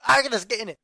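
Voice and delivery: loud, high Scottish outburst; Scottish accent slip. Pretend. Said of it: "Scottish again!".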